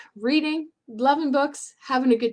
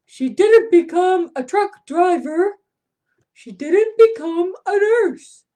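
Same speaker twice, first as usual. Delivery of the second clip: put-on lively read-aloud for kids, a bit louder; storybook reading; 5 semitones higher, 5 dB louder, slower than usual. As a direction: read it like a picture book to little ones. Reads picture book to children.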